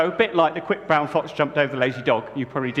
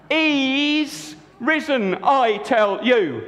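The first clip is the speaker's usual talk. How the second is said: joyful insistence; 8 semitones higher, much slower than usual. Acted. Mary insists to the two men he is risen. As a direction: lean in and insist with joy. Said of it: "she said".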